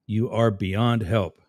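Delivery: slow, each word spaced out; slow and deliberate. Shown as a demonstration. Host repeating it to a chat troll, slow and deliberate. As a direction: spell it out slowly for a slow listener.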